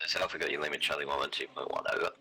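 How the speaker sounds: tinny radio transmission; helmet radio. Real, not acted. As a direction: heard through the headset radio. Radio reply over comms.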